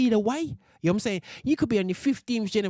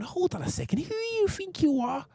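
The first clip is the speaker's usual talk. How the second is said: sharp, incredulous challenge, much higher; indignant challenge; 10 semitones higher, 3 dB quieter than usual. Retold or imitated. Telling off those who claim the land, indignant and high. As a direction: demand who they think they are. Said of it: "it's a case of going".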